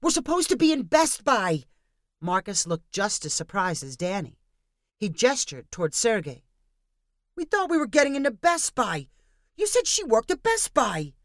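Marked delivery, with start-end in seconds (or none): 0.0-1.6 high, loud panicked cry; alarmed cry. A narrator's reading. Danny realizing they came out in Michael's, alarmed cry. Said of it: "he cried". 7.4-11.1 higher, louder indignant protest; accusing complaint. A narrator's reading. Marcus turning on Sergei for the wrong store, indignant.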